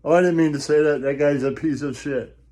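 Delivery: normal talking voice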